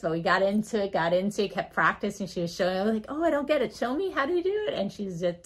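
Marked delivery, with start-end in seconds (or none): 3.1-4.7 playful, high-pitched pleading; feigned confusion. Retold or imitated. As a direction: play clueless to coax a demonstration. Doctor playfully feigns not getting it.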